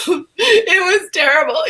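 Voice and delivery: retold tearful wail to a friend; half-laughing, half-sobbing complaint; mock tearful whine; crying. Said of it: "I'm crying on the phone".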